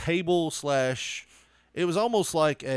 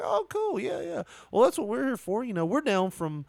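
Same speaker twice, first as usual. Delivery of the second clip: eager stranger; 8 semitones higher than usual. Retold or imitated. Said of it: "I was like".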